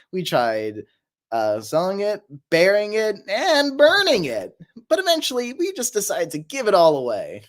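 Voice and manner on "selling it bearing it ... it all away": hammed-up list climbing higher; SpongeBob line. Quoting SpongeBob to the chat from memory, hammed up. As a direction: act out a half-remembered cartoon quote, pitch rising. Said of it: "What's the SpongeBob line?".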